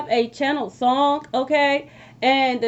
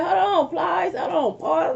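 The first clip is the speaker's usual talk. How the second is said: mock shout of "hold on"; calling out to stop; 6 semitones higher than usual. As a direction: stop him mid-verse, half-yelling. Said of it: "And I'm like".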